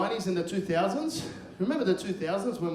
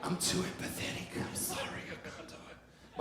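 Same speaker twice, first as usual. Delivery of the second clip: whispered, trailing off to almost nothing; hushed, breath-only apology; apologetic whisper; 10 dB quieter than usual. Acted. Comic confides he cannot do crowd work, in an apologetic whisper.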